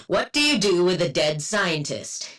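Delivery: normal talking voice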